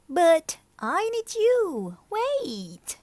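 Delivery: high, slow begging voice; pleading lizard. Acted. The lizard begs Martina not to leave, high and slow. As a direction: beg her to stay.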